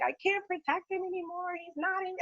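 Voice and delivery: soft, high, half-remembered sobbing plea; retold tearful plea. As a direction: relive a new mother's overwhelmed cry to her mom. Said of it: "And I'm crying and I'm like".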